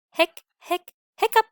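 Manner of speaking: high, loud squeaky hiccups; lamb hiccups. Acted. Lamb with hiccups, loud high hiccups. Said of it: "she got the hiccups".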